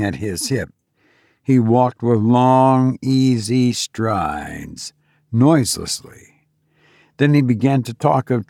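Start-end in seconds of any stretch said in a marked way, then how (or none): none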